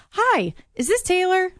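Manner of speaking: high, chirpy phone greeting; bright caller voice. Retold or imitated. Sales caller greeting a stranger on the phone, high and chirpy. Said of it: "It's this girl being like".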